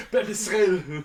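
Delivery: a loud, lightly mock German "Peppy Schnill"; bad German. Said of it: "German bad".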